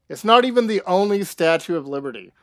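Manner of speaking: high, loud and fast, rushing the fact out; excited burst. Real, not acted. Streamer blurting trivia to chat, loud and fast. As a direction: blurt it out with a spike of enthusiasm.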